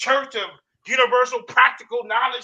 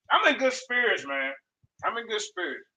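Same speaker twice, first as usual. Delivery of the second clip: ordinary delivery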